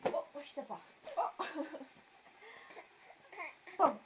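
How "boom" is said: ordinary talk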